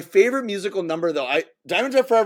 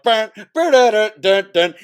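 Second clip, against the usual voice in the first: punchy sung-out trumpet notes; loud mock trumpet intro; imitated trumpet fanfare; 6 dB louder than usual. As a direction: sound out a brass fanfare with your mouth. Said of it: "like, this trumpet".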